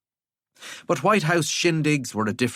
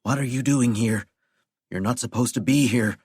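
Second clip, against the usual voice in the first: ordinary delivery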